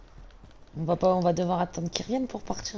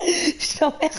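laughing; 5 semitones higher, much slower than usual. Real, not acted. Laughing at teammate's teasing.

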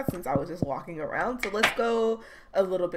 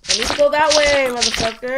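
loud; 5 semitones higher, 8 dB louder than usual. Real, not acted. Urgent dash in game.

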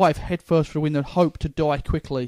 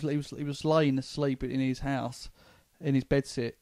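ordinary talk

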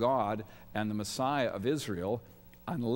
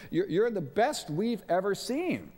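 higher and slow, overflowing; gushing praise; 8 semitones higher, slower than usual. Retold or imitated. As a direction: heap it on eagerly. Disciples gushing to Jesus.

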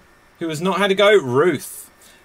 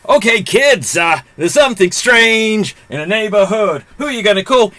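put-on loud, raised theatrical pitch to kids; high, punchy mock-heroic voice; hammed-up film showman; 6 semitones higher, 6 dB louder than usual. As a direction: belt it out like a corny film intro. Host acts out Ghostbusters retelling.

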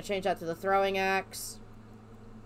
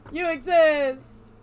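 drawn-out mock echo; 5 semitones higher, 7 dB louder, much slower than usual.

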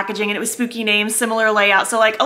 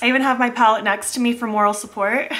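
normal talking voice

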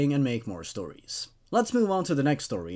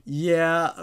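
ordinary delivery, nothing marked

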